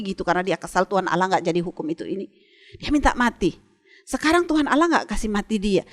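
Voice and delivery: usual speaking voice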